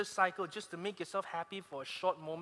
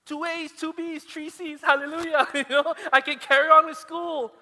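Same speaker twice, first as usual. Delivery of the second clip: much higher, loud laughing hallelujah; gleeful celebration; 10 semitones higher, 12 dB louder than usual. Retold or imitated. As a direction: celebrate like a miracle. Celebrating passing results.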